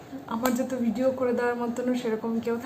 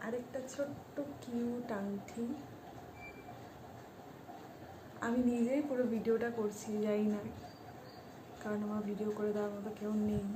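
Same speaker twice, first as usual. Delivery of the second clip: speaking softly; 16 dB quieter than usual. Real, not acted. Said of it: "আমি এই জন্য আস্তে আস্তে কথা বলছিলাম".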